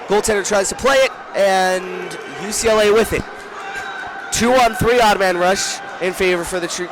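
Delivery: excited call. Real, not acted. Commentator calling a sudden odd man rush, excited. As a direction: call the breakaway with real excitement. Fast breakaway during live play.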